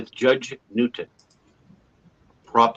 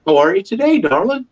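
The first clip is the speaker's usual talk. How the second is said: higher, sing-song Southern "darling"; gas-station clerk greeting him, higher Southern lilt; sweet Southern greeting; 9 semitones higher, 4 dB louder than usual. Retold or imitated. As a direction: play the friendly counter lady. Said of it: "the lady behind the counter".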